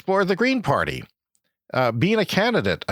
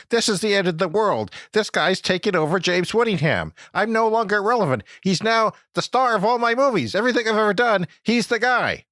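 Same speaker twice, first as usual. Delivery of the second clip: mock panic; 7 semitones higher than usual. Comic self-pity rant.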